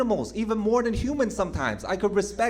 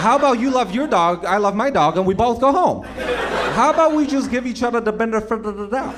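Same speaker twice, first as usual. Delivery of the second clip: louder, exasperated plea for peace; loud appeal; 2 semitones higher, 7 dB louder than usual. Acted. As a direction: shout a truce at them. Calling for truce.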